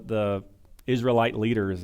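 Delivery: ordinary talk, nothing marked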